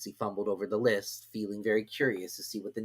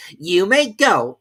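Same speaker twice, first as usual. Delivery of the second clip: high, loud, slow dismissal; high loud king; king dismissing; 9 semitones higher, 12 dB louder, much slower than usual. A narrator's reading. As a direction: send him off. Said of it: "said the king".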